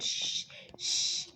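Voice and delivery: unvoiced, drawn-out shushing; hushing. Mom shushing her laughing kid, hushing. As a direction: hush someone with two soft shushes.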